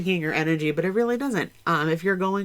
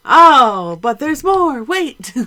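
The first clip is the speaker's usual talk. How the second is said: high, loud "but wait, there's more"; infomercial announcer; 7 semitones higher, 11 dB louder, much slower than usual. Pretend. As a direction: ham it up like a TV ad. Mock infomercial interruption.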